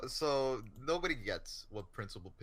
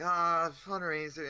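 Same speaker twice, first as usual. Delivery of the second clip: sighing groan; 6 semitones higher, 5 dB louder than usual. Retold or imitated. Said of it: "so everyone's sighing".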